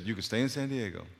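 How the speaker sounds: soft, understated retelling; simple, quiet voice. Retold or imitated. Preacher repeats what God told him, simply and quietly. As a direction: imitate God's small voice, no emphasis. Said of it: "Just like that".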